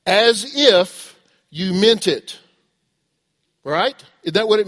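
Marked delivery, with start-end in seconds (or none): 0.0-2.4 loud, slow, word-by-word parental order; parent instructing child. Acted. Parent telling children how to apologize, slow emphatic order. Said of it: "We are telling our children".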